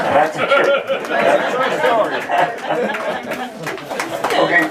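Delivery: a tall-tale teller laughing that it's true. Real, not acted. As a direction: push the words out through laughter. Laughing claim after tall tale.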